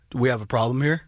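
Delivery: low-pitched mock menace; confrontational husband. Husband two inches from his face, low confrontational challenge. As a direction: imitate a husband squaring up, voice dropped way down. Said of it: "gets two inches in my face and goes".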